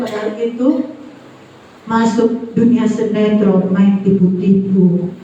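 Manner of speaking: ordinary talk, nothing marked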